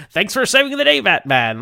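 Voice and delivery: a hint of a stiff police commissioner; mocking Commissioner Gordon. Host reciting Gordon's few lines, mild mock voice. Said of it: "I could probably recite them all".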